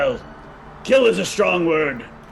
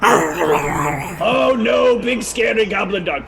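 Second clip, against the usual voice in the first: sarcastic fake fright, a bit louder; mock fear; 4 semitones higher, 4 dB louder, slower than usual. Dwarf mocking the goblin's dog, sarcastic fake fright. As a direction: pretend to be scared of a small dog. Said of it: "makes it like".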